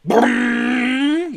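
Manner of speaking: high, loud mock engine roar; engine noise yell. A narrator's reading. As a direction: make a loud car-engine sound. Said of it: "yelled Joe loudly".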